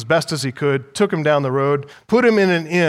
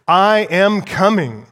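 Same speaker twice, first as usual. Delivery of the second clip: slow, louder and deliberate, I am coming; emphatic; 3 semitones higher, 4 dB louder, slower than usual. Shown as a demonstration. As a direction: press each word of I am coming. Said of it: "making it emphatic here".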